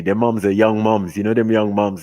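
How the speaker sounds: ordinary delivery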